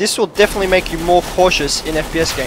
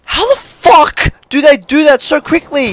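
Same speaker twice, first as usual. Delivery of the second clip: high, loud outraged question; exasperated high-pitched demand; incredulous shout; 8 semitones higher, 4 dB louder than usual. Real, not acted. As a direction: yell it in disbelief. Rages at quick enemies.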